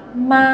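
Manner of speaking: "ma" high and level; first tone. Shown as a demonstration. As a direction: hold "ma" high and flat. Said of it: "Yang pertama". ("ma" nada pertama, tinggi dan datar)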